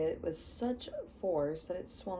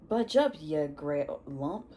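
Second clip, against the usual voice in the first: loud, gruff West Country Hagrid; Hagrid accent; 7 dB louder than usual. Acted. Giant telling Dudley to move, in Hagrid accent. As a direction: shove the boy aside in a Hagrid accent. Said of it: "he has his accent".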